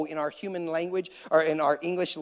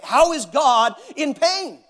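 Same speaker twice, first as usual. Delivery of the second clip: climactic preaching; 9 semitones higher, 11 dB louder than usual. Acted. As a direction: build to the peak of the sermon. Sermon climax.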